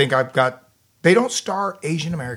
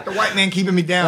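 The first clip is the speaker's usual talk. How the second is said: mock grievance, laughing; 4 semitones higher than usual.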